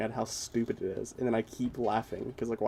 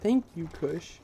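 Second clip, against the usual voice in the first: mock gushing; 7 semitones higher, 4 dB quieter than usual. Gushing mock thanks to Kush.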